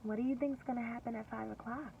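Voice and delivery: usual speaking voice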